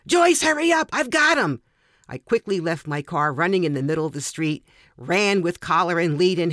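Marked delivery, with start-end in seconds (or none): 0.1-1.6 high, loud urgent yell to a neighbor; screaming. Acted. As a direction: yell for help while pinning the dog.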